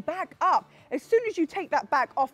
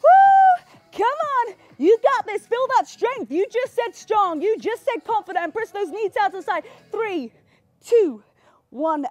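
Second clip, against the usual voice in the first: high energetic workout cues; aerobics instructor; 5 semitones higher, 5 dB louder than usual. Acted. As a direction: pump up the class. Instructor counting reps with energy.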